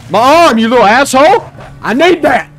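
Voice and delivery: high, loud shout; fighting off a wolf, yelling; pained angry yell. Real, not acted. Yelling at wolf biting arm.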